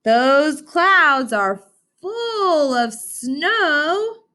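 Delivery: very slow, high sing-song fox. Acted. Fox spotting snow clouds, high and slow. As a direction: stretch it out high like an eager fox. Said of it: "said Fox".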